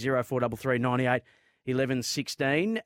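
ordinary delivery

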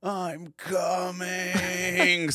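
mock scream. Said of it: "this guy just screaming".

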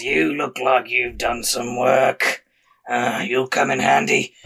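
slow, rough orc appraisal; gravelly foreman drawl; gravelly half-orc. Acted. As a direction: growl approval at a sturdy worker. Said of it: "sometimes the gravelly voice takes a lot".

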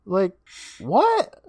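very high exasperated disbelief; mock incredulity. Podcaster scolding sceptical boy about the mirror girl, high mock incredulity. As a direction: squeal the question in comic outrage.

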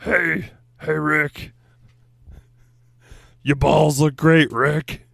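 high, loud goofy cartoon voice; playful goofy mascot voice; silly Grimace voice. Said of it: "Does Grimace talk?".

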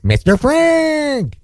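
high, long-stretched name; dad's Mister greeting. Retold or imitated. Son imitating dad addressing everybody, high drawn-out call. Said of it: "he calls everybody by Mister".

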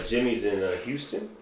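ordinary talk

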